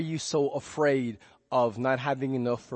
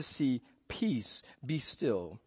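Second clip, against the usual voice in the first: calm command; 3 semitones lower, 7 dB quieter, slower than usual. Retold or imitated. Jesus calming the storm.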